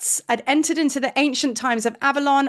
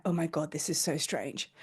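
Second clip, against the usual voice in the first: hushed awe; 8 semitones lower, 12 dB quieter than usual. Retold or imitated. Hushed awe at strange place.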